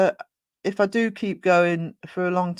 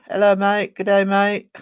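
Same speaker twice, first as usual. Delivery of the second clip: hammed-up, louder Australian greeting; bad Australian accent; 2 semitones higher, 8 dB louder than usual. Streamer jokily greets a viewer, bad Australian accent. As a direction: have a poor go at an Australian accent. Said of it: "Worst Australian accent ever".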